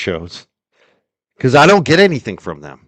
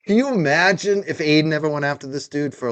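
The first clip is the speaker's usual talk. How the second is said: ordinary delivery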